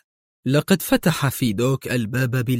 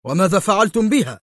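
high-pitched urgent question; worked-up, raised voice; agitated; 7 semitones higher, 4 dB louder than usual. A narrator's reading. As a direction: ask it with rising agitation. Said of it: "سأله برنارد في انفعال".

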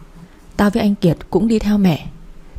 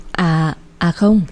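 slow, halting denial; stammering; much slower than usual. A narrator's reading. Caught-out friend hurriedly covering up to An, stammering. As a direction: stumble over the first sound, stalling. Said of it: "Gì mà lắp bắp vậy má?".

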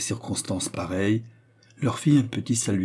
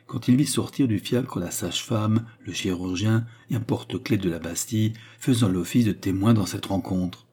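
plain reading voice

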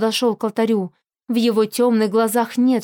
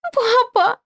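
put-on high child's cry; little girl crying out; 12 semitones higher than usual. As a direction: cry out like a small girl in pain.

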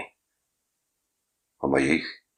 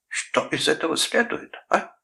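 high-pitched indignant echo, rising; mock the question back in outrage; 13 semitones higher than usual. A narrator's reading. Uncle throwing nephew's words back, indignant. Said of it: "возмутился дядя Гарольд".

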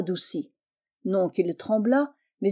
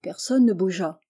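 usual speaking voice

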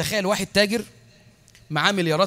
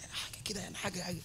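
timid whisper; 2 semitones lower, 18 dB quieter, faster than usual. Retold or imitated. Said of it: "تقعد تعمل في رقبتك كده".

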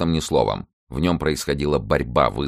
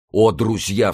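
heartfelt cry; 7 semitones higher, 5 dB louder, slower than usual. A narrator's reading. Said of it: "вскричал Атос с невыразимым чувством".

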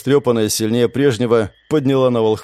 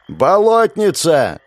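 slow, louder summoning call; 6 semitones higher, 4 dB louder, much slower than usual. A narrator's reading. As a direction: call her out over the noise. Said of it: "снова окликнул он".

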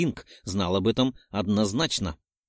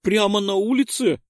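put-on high little girl; 10 semitones higher, 5 dB louder than usual. A narrator's reading. Girl confirming eagerly.

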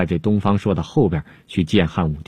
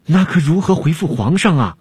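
higher, flustered plea; panicked question; 7 semitones higher than usual. Acted. Alchemist frets to Dongfang Shuo, panicked higher question. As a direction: ask in a panic, voice pitched up. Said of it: "惊慌失措地问".

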